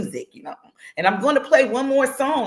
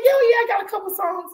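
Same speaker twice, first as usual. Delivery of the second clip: imitating a friend in a much higher voice; high-pitched quote; 12 semitones higher, 4 dB louder than usual. Host quoting [name] about her songs, high-pitched. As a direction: lift it high for a casual, offhand reply. Acts out guest's casual reply.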